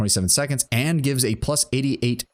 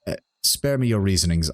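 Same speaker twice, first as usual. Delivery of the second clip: usual speaking voice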